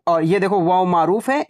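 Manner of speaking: normal talking voice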